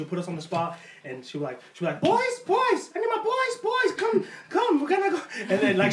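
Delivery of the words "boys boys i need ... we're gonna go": put-on high-pitched mom calling her boys; mock summons, pitched way up; imitating mom. Mom on the mic calling her sons, high imitation. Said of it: "my mom would always put this".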